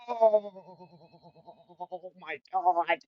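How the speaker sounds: high, soft, drawn-out groan; pained moan. Acted. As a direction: moan it weakly after a fall. Character groaning after painful fall.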